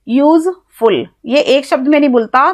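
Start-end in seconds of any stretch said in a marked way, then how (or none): 0.1-1.1 useful parted into यूज and फुल with a short gap; said as two words. Shown as a demonstration. Teacher proving the word needs two pieces, useful split into two beats. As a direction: separate यूज from फुल so both syllables stand out. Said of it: "दो शब्दों में बोलता है".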